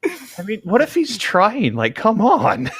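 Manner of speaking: slow high pleading voice; high defensive voice. Pretend. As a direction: plead Jim's case in a squeak.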